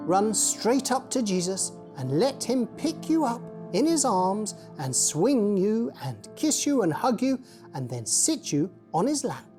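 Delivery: ordinary delivery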